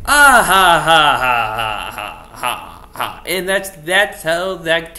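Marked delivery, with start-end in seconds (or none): 0.1-3.2 big loud laugh tumbling down in pitch; hammed-up stage laugh, fading out; theatrical laughter. Theatrical laugh ending the act.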